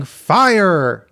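big hammed-up cry of alarm; excited yell. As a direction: yell it out like a gleeful false alarm. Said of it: "and he's yelling".

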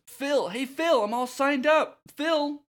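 put-on eager young hero, very high and loud; Herc. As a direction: call out excitedly to your trainer. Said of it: "and Herc says".

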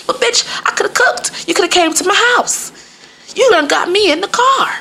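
high-pitched exasperated rant; hangry high-voiced tirade; going off on her. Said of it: "I just went the fuck off on her".

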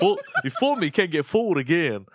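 imitating George Bush's Southern accent to a laughing friend. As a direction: ham up a Southern president fumbling the saying. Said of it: "in his little Southern accent".

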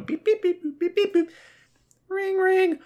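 very high, even dial-tone beeps; imitated phone beeps. Acting out calling a friend on the phone, high mimicked beeps. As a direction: mimic a phone dialing and ringing, high-pitched.

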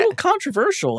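falsetto squeak on "controversial"; mock high voice. Teasing friends about the saloon, high silly voice.

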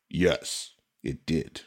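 ordinary delivery

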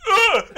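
loud high hammed-up grunt; mock spear-throw grunt; grunt of effort. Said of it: "and he goes".

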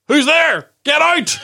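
high, loud shout downstairs; shouting down the stairs. Retold or imitated. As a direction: yell at the intruder below. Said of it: "I shouted down the stairs".

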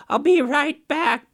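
a sneaky trickster promising to return; drawn-out fake reassurance; Raven's voice. Raven hurries off.

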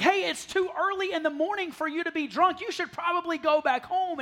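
high-pitched mild reproach; mock scolding priest. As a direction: tell off someone you think is drunk. Priest scolds Hannah he thinks drunk.

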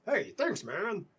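high, bright thanks from the bard; eager bard thanks; excited dwarf bard. Acted. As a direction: squeal a happy thank-you.

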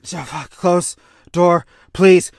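slow, loud panicked begging; retold panic, loud and drawn out; panicked plea. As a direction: beg desperately to shut the door. Captain panicking after force push.

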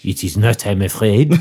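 mock French waiter, mademoiselle drawn down low; thick French accent. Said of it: "This is French".

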